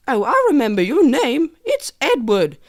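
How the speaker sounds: high-voiced British mum calling her child; hammed-up British mum; British accent. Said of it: "pioneers had British accents in that scene".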